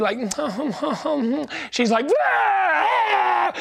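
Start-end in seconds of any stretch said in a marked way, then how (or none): 0.2-1.7 dainty mock sobs, soft and fluttering; pretty crying. Preacher mimicking the congregation's pretty crying, dainty mock sobs. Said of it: "She's not pretty crying like some of you". 2.1-3.5 long, high mock wail; loud crying. Preacher imitating the crying girl on the plane, loud high wail. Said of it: "crying so loudly and, uh, and aggressively".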